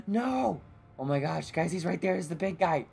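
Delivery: ordinary talk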